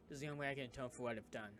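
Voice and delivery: ordinary delivery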